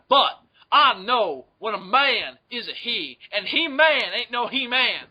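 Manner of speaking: high, slow Southern courtroom drawl; southern lawyer. Acted. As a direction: preach it like a country lawyer. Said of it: "Southern lawyer voice".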